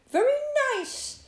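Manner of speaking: high-pitched little rabbit compliment; rabbit's praise. A narrator's reading. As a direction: pipe up as an approving rabbit. Rabbit politely praises the hat.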